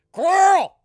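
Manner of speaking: very high, loud yell of Coral; shouted Coral with a Rick accent; Rick calling Carl. Retold or imitated. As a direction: call out Coral across a yard, frantic. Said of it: "it's like coral".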